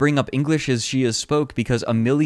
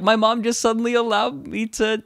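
put-on higher-pitched child's quote; imitated little kid; 10 semitones higher than usual. Translating for viewers what the boy says, imitated kid voice. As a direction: lift it up like an excited kid.

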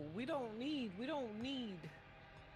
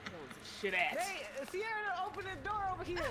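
ordinary talk, nothing marked

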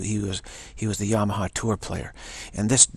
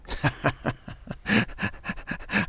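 airy laugh; 5 semitones higher, 4 dB quieter than usual. Real, not acted. Laughing at her playfulness.

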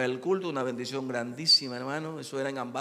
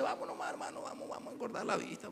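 whispered coaxing; 8 semitones higher, 9 dB quieter, faster than usual. Retold or imitated.

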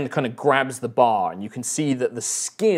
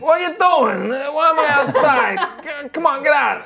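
put-on high, loud groggy drunk breaking into a laugh; confused and cranky, half laughing; drunk barkeeper, laughing; 13 semitones higher, 5 dB louder than usual. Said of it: "He's like".

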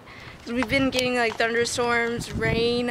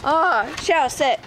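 ordinary talk

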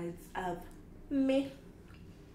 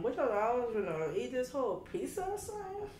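put-on New York accent; 5 semitones higher than usual. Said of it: "How y'all like my New York accent?".

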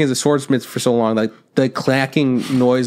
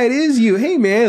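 high, cheerful mock guard; fooled guard; 9 semitones higher than usual. Fooled guard greeting the disguised sidekick, high and cheery. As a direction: greet him like an old friend.

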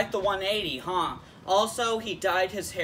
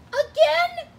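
very high, loud squeaky question; Pikachu; 7 dB louder than usual. Acted. Sidekick interrupting about the repeated bowing, incredulous squeak. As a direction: squeak it out in disbelief. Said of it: "Sure, Pikachu".

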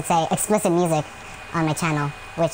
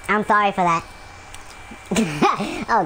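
normal talking voice

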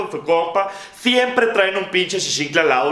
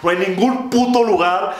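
ordinary talk

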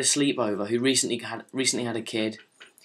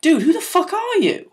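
high, disbelieving squeal; mock shock at the stranger; pitched way up, who the hell are you; incredulous; 4 dB louder than usual. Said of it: "And it's like".

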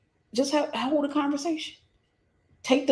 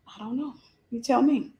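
put-on coy girlfriend; 3 dB quieter than usual. Woman's coy reply in acted dialogue.

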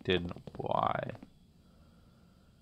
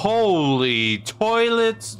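high, slow disbelieving question; stunned slow holy toilets; shocked question; 7 semitones higher, slower than usual. Real, not acted. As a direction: stare at it in disbelief. Shocked at strange room.

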